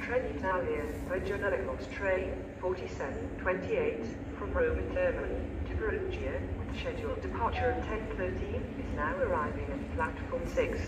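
PA loudspeaker announcement voice; echoing station tannoy; train station announcement. Real, not acted.

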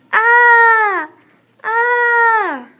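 falling scream. Acted. Falling into the painting.

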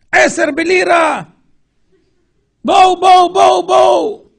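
high, loud hawker's cry, slowed; market hawker yell. Retold or imitated. Preacher imitates a shouting market vendor's cry. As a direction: call out wares like a stallholder. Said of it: "צועק".

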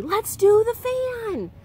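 rally your brother loudly; urgent call. Acted. Stanley calling to Arthur, slow loud rallying shout.

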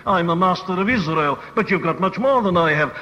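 usual speaking voice

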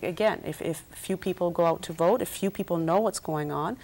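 ordinary delivery

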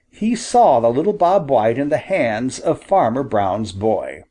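ordinary delivery

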